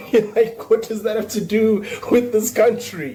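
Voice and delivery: high, laughing incredulous question; baffled imagined viewer, laughing high; laughing disbelief. Retold or imitated. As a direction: ask it while cracking up.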